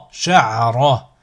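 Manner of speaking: syllables joined as one word. Shown as a demonstration. Teacher models a word for learners to repeat, joined in one go. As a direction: run the syllables together without pauses. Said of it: "ensuite le mot".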